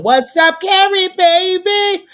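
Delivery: high, loud, drawn-out hello; loud greeting. Real, not acted. Host greeting a friend who just joined, loud and high. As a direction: call out to a friend arriving.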